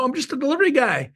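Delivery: high, quick, breezy cover line; innocent act. Retold or imitated. Agent slips in the back door, putting on an innocent act. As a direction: toss it off like you belong there. Slipping in posing as delivery guy.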